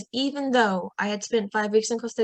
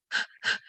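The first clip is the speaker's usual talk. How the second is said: excited gasp; 4 dB quieter than usual. Retold or imitated. Hearing the London plan.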